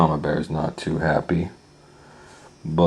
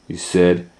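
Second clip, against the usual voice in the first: usual speaking voice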